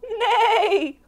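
high, loud, wavering horse whinny; cow's big neigh. A narrator's reading. Reading to children as the cow lets out a neigh, big whinny. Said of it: "let out a big".

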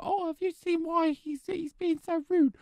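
high falsetto mimicry of whiners; mocking complaint. Judge mimicking the two guests complaining, mocking falsetto. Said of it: "don't you start being all like".

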